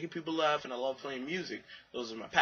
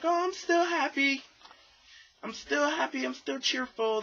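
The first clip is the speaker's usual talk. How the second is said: much higher, falsetto fake-cheer voice; forced cheerful; 13 semitones higher, 4 dB louder than usual. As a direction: squeak out a desperate cheerful act. Mocking forced cheerful people.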